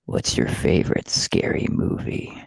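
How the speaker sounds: deep, slow, whispered phone killer; low slow menacing whisper; Ghostface voice. Retold or imitated. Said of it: "You gotta go with that voice".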